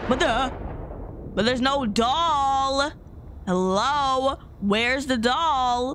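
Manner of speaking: ordinary delivery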